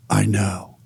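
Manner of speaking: low, matter-of-fact reply; deadpan mock-knowing answer; unfazed. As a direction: imitate an unfazed magician, pitched low. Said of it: "without missing a beat, looks at him".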